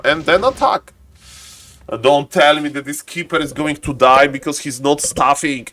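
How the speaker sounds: normal talking voice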